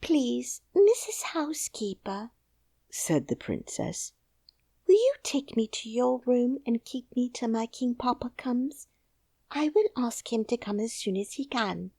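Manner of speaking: high, hushed little princess voice. Acted. Princess asks the housekeeper, high and hushed.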